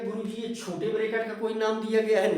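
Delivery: imagined student's question. Pretend. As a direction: voice a student asking the teacher, fast. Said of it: "तो आपके दिमाग में चलेगा".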